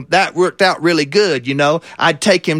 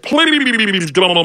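talking catfish pleading; 3 semitones higher than usual. Acted. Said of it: "that fish spoke to me and said".

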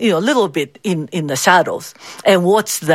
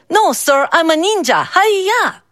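put-on high, cheerful child voice with karate yell; unfazed little boy answering the old man; bold little boy; 10 semitones higher than usual. A narrator's reading. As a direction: chirp it fearlessly like a kid ninja.

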